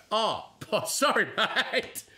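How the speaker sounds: high, drawn-out mock apology with a laugh. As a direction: laugh through a hammed-up, apologetic reply. Mocking expected reaction to excuse.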